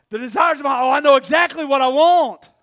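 loud, high, eager outburst; excited eagerness. Retold or imitated. Preacher acting his new-believer self reading a promise, excited.

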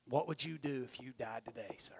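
whispered child's question, unvoiced; soft whispered voicing of a little girl; hushed girl's question. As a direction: breathe it like a small girl asking.

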